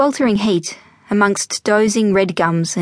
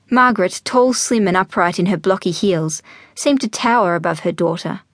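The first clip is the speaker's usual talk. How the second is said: plain reading voice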